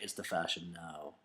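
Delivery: low put-on voice for the girl; bearded girl's voice. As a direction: drop the voice low and flat for her.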